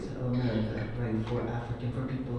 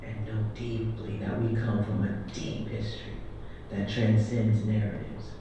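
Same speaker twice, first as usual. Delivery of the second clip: measured, declaiming poem recital; spoken-word poem; 2 semitones lower than usual. Acted. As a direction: proclaim each line like a stage poet.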